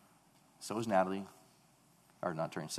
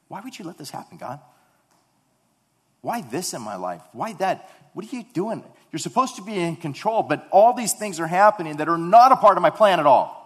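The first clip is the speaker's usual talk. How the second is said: complaining to God; 8 semitones higher, 11 dB louder than usual. Retold or imitated. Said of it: "we try to counsel God".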